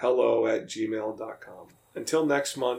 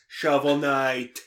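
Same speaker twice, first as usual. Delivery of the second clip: slow, loud New Jersey accent; New Jersey mob accent at the front of the mouth; mobster accent; 7 dB louder, slower than usual. Shown as a demonstration. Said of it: "at the front of the mouth".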